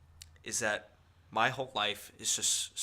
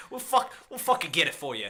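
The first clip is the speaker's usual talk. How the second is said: higher, louder Boston bro; Boston accent; 5 semitones higher, 8 dB louder than usual. Pretend.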